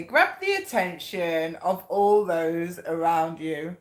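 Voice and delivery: slow, measured card reading. Real, not acted. Reader announcing the card's message to viewers, slow read-out. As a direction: read it out slowly, stretching the phrase. Reads card out, then repeats it.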